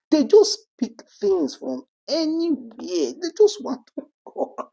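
high, loud exasperation; real fed-up outburst, pushed high; exasperated rant. Real, not acted. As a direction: let the pitch climb in disbelief. Rant at people picking quarrels.

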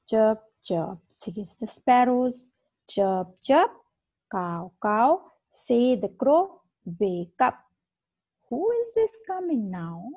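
slow, sing-song classroom recitation; reading a poem to children. Acted. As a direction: read it aloud like a teacher leading young pupils. Teacher reads poem to class.

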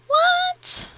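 very high, loud squeak of surprise; astonished. Real, not acted. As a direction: squeak it in disbelief. Surprised by game feature.

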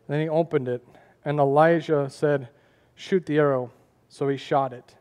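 soft, low, unhurried Bible storytelling; hushed preacher narrating Kings; quiet scripture retelling. Acted. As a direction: tell the old story quietly to the congregation.

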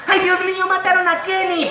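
high cartoon kid cry; Stan voice. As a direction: yelp it like a cartoon boy. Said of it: "Me tocó doblar a Stan".

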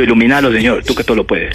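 low-pitched mock supplication; retold prayer. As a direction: pray low for a miracle. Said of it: "decía yo". (plegaria en voz grave)

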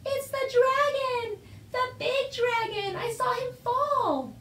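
high, drawn-out distant call; shouting. A narrator's reading. Boy spotting the fallen dragon, high excited shout. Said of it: "he heard someone shouting".

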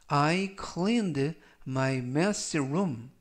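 "A vegetarian doesn't eat meat" read very slowly, word by word; slow model reading. Shown as a demonstration. Teacher reads the example sentence slowly to students. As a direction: spell out each word slowly. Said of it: "전체적 의미는".

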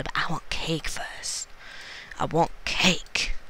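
slow breathless whisper of wanting; whispered craving. Acted. Chased by a monster, she whispers to herself about cake. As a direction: hush it out like a secret craving.

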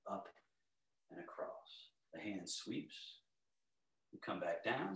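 calm, unhurried movement cues; serene tai chi cues. Acted. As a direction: guide the class gently through each move.

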